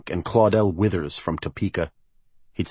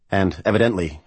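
usual speaking voice

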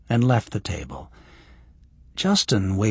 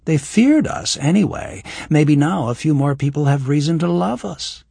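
put-on higher young woman's voice; lightly voiced young woman; Kira's voice; 6 semitones higher, 4 dB louder than usual. Voices Kira.

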